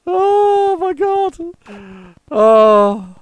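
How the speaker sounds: high, loud wail of pretend horror; mock screaming. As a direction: imitate someone screaming at a spider. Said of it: "screaming and shouting going on".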